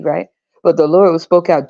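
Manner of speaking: ordinary talk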